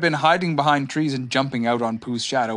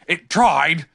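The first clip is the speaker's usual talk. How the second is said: louder put-on animal voice; curious creature with its own timbre; Tigger; 4 semitones higher, 6 dB louder than usual. A narrator's reading. Tigger learns what attacked him, loud character voice. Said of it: "said Tigger".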